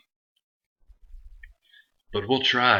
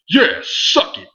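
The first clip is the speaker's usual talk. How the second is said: shouted jeering taunt, higher and louder; mock taunt; 11 semitones higher, 5 dB louder, slower than usual. Host voicing a heel taunting Ryback, shouted jeer. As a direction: yell it like a gloating heel.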